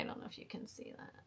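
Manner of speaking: fast unvoiced whisper aside; whispered aside to the camera. Real, not acted. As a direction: drop to a quick whisper to the viewer. Aside to camera about the scoop.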